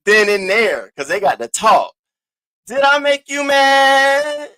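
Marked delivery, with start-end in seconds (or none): 2.7-4.5 drawn out, a touch higher, stress on I; slow mocking taunt. Real, not acted. Taunting a rival streamer who went live angry, slow and mocking. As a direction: tease slowly, leaning on "I".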